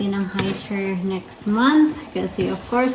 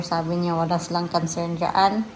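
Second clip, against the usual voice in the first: ordinary delivery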